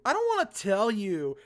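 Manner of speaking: put-on very whiny girl's complaint. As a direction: whine it like a sulky refusal. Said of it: "saying this in a very whiny tone".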